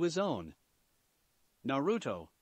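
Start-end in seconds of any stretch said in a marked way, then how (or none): 1.6-2.3 flat, deadpan monotone. A narrator's reading. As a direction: say the name dead flat, unimpressed. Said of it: "her monotone, deadpan voice".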